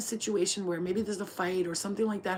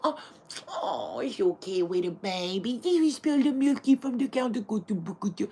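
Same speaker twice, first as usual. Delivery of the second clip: put-on baby talk; 2 semitones higher, 6 dB louder than usual. Soothing a kitten that spilled milk, in cooing baby talk. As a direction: coo at it like it spilled milk sweetly. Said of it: "You'd be like".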